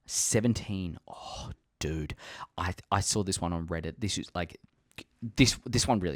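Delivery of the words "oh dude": breathy hushed aside; whisper. Real, not acted. Vlogger hushing an excited aside, a whisper. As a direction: whisper it to the camera.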